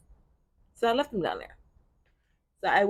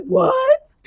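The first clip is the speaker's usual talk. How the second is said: high, loud, squeaky cartoon-dog "what"; Scooby-Doo "what"; 11 dB louder than usual. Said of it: "I sound like Scooby-Doo".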